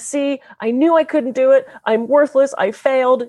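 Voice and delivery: put-on a child's ashamed little voice; shameful little kid. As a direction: whimper it like a crushed child. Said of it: "Your shameful little kid comes out and says".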